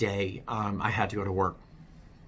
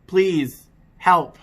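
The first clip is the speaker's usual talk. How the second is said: loud, high cry for help; retold desperate shout for help; desperate plea; 7 semitones higher, 9 dB louder, much slower than usual. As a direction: beg loudly for help. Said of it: "and I'm like".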